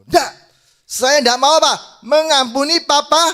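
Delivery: high, very loud defiant shouting; dramatized shouted rage; acted furious refusal. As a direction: refuse at the top of your voice. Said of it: "Dia marah-marah".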